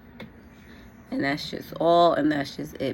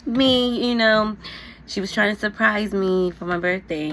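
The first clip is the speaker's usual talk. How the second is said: higher, cutesy imitation of mom; sweet, cute mom; 5 semitones higher than usual. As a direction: play a sweet mother speaking up, pitched high. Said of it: "she's being sweet and cute".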